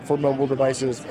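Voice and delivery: ordinary talk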